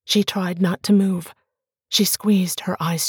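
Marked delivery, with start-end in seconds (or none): none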